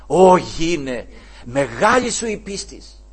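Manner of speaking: slow solemn praise. Acted. Lord praises the woman.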